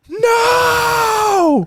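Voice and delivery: long, high, hammy "no"; mock stage anguish; imitated scream. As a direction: wail it like an overacting actor. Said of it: "what was up with the scream".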